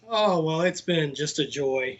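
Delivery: ordinary delivery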